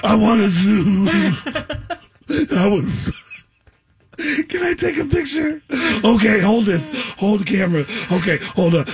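mock blubbering through the words; tearful fan fumbles with a camera, mock crying; crying impression. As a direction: sob out a request for a photo. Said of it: "every impression I did was of somebody crying".

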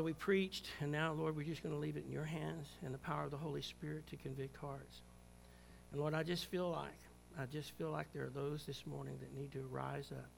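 soft, low, hushed prayer voice; gentle pastoral prayer; closing prayer. Acted. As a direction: pray quietly over the congregation.

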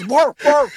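two high mock woofs; imitated pig bark. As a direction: puppet pig barking. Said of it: "hand up in it and goes".

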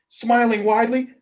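plain reading voice

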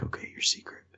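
hushed whisper. Retold or imitated. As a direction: whisper it like a secret pact. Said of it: "And they're like".

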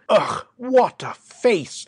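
usual speaking voice

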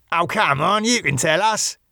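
high, eager coaxing voice; nosy wheedling. Acted. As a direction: pester him for the gossip, pitched well up. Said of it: "listening with more interest than was appropriate".

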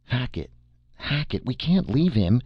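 high, anguished protest, mildly acted; futile raging. A narrator's reading. Norman refuses to abandon his captured friend, futile high-pitched rage. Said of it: "Norman raged futilely".

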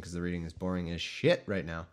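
usual speaking voice

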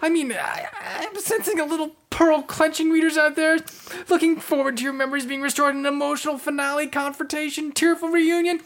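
high, fast fussy voice; a fretful narrator-god in a high voice; jittery high voice, hedging; high put-on voice.

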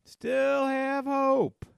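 long, pleading hope; slow, drawn-out. Acted. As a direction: stretch out Still have hope, high and slow. Repeating his hopeful line.

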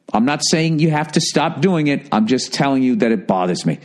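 ordinary talk

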